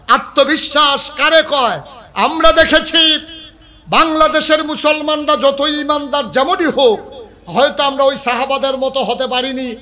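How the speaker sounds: high, impassioned pulpit oratory; rally the congregation; full-force preaching. Real, not acted.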